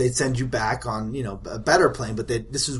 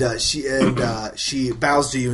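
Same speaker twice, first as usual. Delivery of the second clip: ordinary talk, nothing marked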